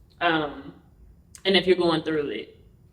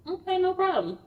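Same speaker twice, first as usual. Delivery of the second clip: appeasing; 13 semitones higher than usual. Retold or imitated. Appeasing a violent partner.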